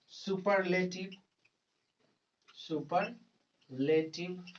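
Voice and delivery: word by word, long pauses for note-taking; teacher dictating slowly to students; slow dictation. Acted. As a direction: dictate a definition in small, measured chunks. Dictates a rule while writing.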